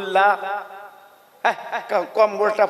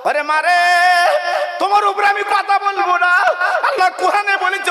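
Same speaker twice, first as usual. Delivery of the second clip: anguished cry; 9 semitones higher than usual. Acted. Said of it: "ছেলে বলে".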